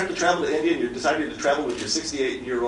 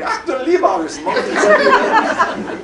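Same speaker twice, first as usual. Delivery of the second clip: panicked German tourist; 12 semitones higher, 8 dB louder than usual. Retold or imitated.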